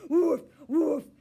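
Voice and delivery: loud, high playful woofs; puppy barks. A narrator's reading. Puppy barking at the hamster to play. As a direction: bark like an eager puppy. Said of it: "she barked".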